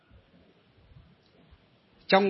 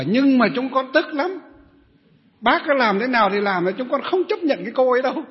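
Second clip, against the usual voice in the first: high, loud indignant protest; stubborn grudge; 6 semitones higher, 4 dB louder than usual. Retold or imitated. Old women refusing the monk, loud stubborn protest. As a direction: insist you will never accept her. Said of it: "Các cụ bảo".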